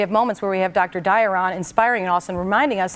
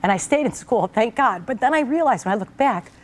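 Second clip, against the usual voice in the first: usual speaking voice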